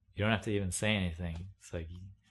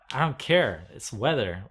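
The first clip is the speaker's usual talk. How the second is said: higher, louder, curt and fed up; annoyed; 4 semitones higher, 8 dB louder than usual. Real, not acted. Venting to viewers about weather, annoyed and loud. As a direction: dismiss it hard. Continues the outburst.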